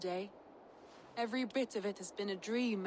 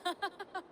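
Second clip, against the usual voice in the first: laugh; 10 semitones higher than usual. Acted. Nervous laugh after confession.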